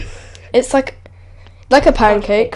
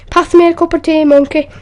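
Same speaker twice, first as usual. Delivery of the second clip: a hint of northern English accent, raised and louder; imitating a northern English bloke asking for tea; 7 semitones higher, 5 dB louder than usual. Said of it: "a proper northern accent".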